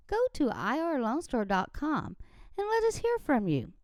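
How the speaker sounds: ordinary talk, nothing marked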